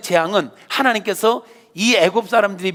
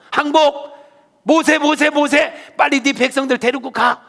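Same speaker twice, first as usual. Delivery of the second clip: frantic surrender; 7 semitones higher than usual. Acted. Pharaoh finally surrenders.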